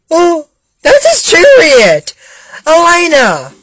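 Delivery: high, loud drawn-out cry; acted shout. Rider shouting to Elena about the chariot, acted shout. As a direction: cry out in high-pitched alarm. Acted excited calling out in story.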